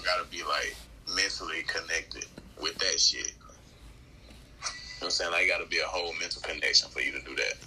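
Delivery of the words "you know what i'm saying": whisper. Real, not acted. Whispered aside.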